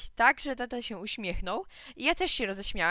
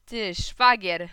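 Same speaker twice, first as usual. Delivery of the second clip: taunting drawl; 11 dB louder, much slower than usual. Acted. Boys quarrel in schoolyard.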